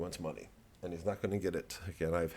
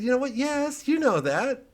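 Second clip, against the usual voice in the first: much higher, mock-indignant squeak; high squeaky retort; 4 dB louder than usual. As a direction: answer in a squeaky falsetto. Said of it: "And I said".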